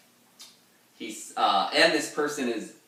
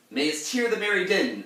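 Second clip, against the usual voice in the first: much higher, louder declaimed quote; theatrical reading; 10 semitones higher, 6 dB louder than usual. Shown as a demonstration. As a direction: declaim the old verse grandly.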